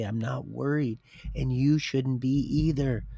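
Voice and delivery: usual speaking voice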